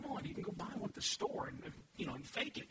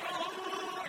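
mock dismay; 9 semitones higher than usual. Reaction to photo.

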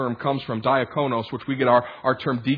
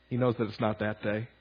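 the repeat of "He knows that it's not that day" said much softer; quiet repeat; 8 semitones lower, 11 dB quieter than usual. Shown as a demonstration. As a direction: let it drop low and soft, almost to yourself. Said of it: "but he knows that it's not that day".

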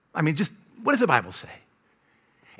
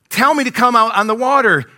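loud, high acted challenge; acting out a disciple calling across the water; testing Peter; 7 semitones higher, 6 dB louder than usual. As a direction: call out boldly as if daring him. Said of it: "starts to kinda test it".

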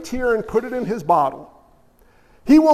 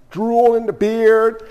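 ordinary delivery

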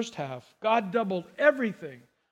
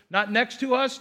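ordinary talk, nothing marked

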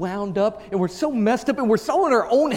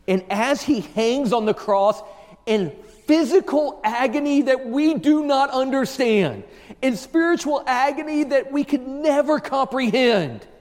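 raised, impassioned preacher voice; full-force preaching; 6 semitones higher, 4 dB louder than usual. Real, not acted. Preacher to congregation on the agony of the cross, full-force preaching. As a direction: drive each phrase home from the pulpit.

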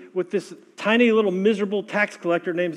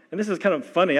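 ordinary delivery

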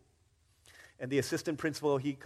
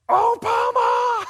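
high, stretched-out, very loud scream of the name; a starstruck girl screaming at her hero; screaming shout; 13 dB louder, much slower than usual. Retold or imitated. Girl spotting Michelle Obama in class, screaming with joy. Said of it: "And she just starts screaming".

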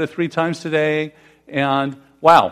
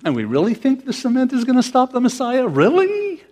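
incredulous; 7 semitones higher than usual. Real, not acted. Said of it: "incredulous rhetorical question".

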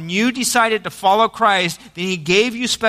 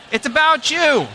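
higher, bright address to a neighbor; telling your neighbor; 7 semitones higher than usual. Shown as a demonstration. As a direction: turn and tell the person beside you. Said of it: "Tell them".

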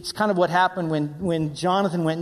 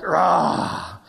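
drawn-out mock battle roar; roaring cheer; 3 dB louder than usual. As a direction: roar like a fan of the champion. Acted cheer for giant-slayer David.

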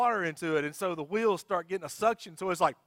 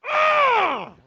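high, loud drawn-out holler; triumphant yell; 11 dB louder than usual. Retold or imitated. Driver finally backed out of mud, long yell. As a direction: let out a big open-throated cry. Acted release once unstuck.

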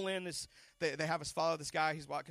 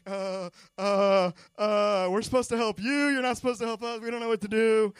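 louder, stammering and flustered; flustered excitement; 4 semitones higher, 16 dB louder than usual. Retold or imitated. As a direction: fumble like starstruck fans. Flustered meeting their hero.

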